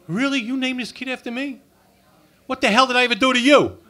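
high, loud outraged disbelief; shocked. Retold or imitated. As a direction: squawk in disbelief. Shocked reply to parents.

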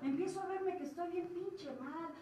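ordinary talk, nothing marked

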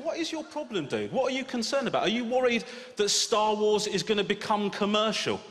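incredulous retort. Retold or imitated. Dad mocking his friend's outrage, high incredulous voice. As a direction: scoff at the complaint.

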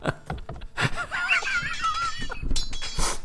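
squeaky high laugh. Real, not acted. Streamer laughing at a giant wall.

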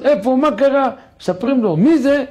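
grieving father. Acted. King sees his dead son.

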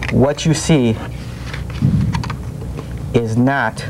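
normal talking voice

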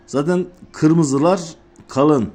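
normal talking voice

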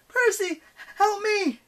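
high, loud cry for help; yelp. A narrator's reading. Annabeth calls Percy to help hold the doors, yelping. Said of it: "Annabeth yelped".

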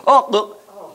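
ordinary talk

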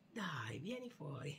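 whispered coaxing to a shy teddy; hushed coaxing for a shy toy. Pretend. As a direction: breathe it out gently to lure him.

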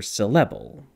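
"syllable" said as suh-LEH-bul, stress on the second syllable; accent the middle of "syllable" with an eh; "syllable" with a full, stressed eh, falling at the end; middle vowel EH. Shown as a demonstration. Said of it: "what vowel am I using".

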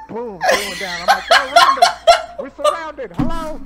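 very high, loud, laughing panic; laughing mock panic. Retelling the squad's panic, very high laughing squeal.

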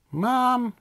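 put-on very high little-girl call; yelling for Mom. A narrator's reading. Little sister at the phone calling Mom, mock yell. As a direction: call out high, without much extra volume. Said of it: "D W yelled".

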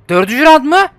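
high, loud exclamations of disbelief; real gamer outburst; outraged disbelief. Real, not acted. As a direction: cry out high at an unfair game. Losing round, outraged at game.

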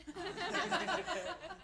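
high, quiet sneaky giggle; mock snickering. Brother gloats while sibling cries, high mock snicker. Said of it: "My brother was like".

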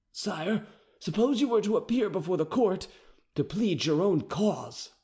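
captain reading the charge, uneasy; anxious captain. A narrator's reading. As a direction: deliver the hard law nervously. Captain pleading with the king.

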